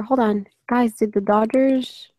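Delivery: ordinary delivery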